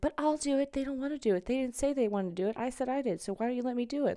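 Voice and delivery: high, whiny pupil protest; hammed-up high insistence; mock pleading. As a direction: plead like an overeager schoolkid. Child self pleading with teachers.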